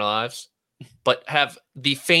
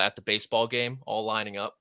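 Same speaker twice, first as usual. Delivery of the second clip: ordinary talk, nothing marked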